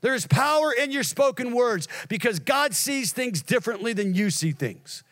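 high, driving sermon voice; impassioned preaching. Real, not acted. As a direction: preach it out, slowing on the last words. Preaching at full force.

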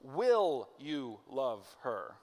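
slow, weighty stress on "Will" in "Will you love her?". Shown as a demonstration. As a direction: lean hard on "Will", drawn out slowly.

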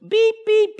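high bleeping "beep, beep" in place of swearing; high, loud mock bleep; mock censor bleep. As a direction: bleep out the cursing with your voice. Imitated censor bleep for curses.

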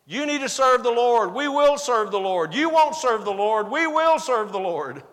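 volley the lines like a quarrel; heated back and forth. Acted. Joshua and the people arguing, loud retorts. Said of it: "It's exactly how it sounds".

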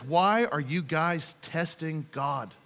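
slow, low, weary frustration; frustrated Moses. Acted. Moses reproving the grumbling Israelites, quietly frustrated. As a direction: let restrained frustration weigh on each word. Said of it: "hear the frustration in his voice".

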